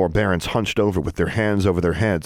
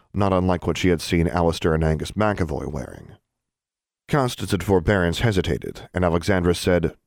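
plain reading voice

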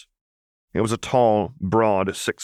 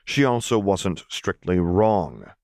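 ordinary delivery

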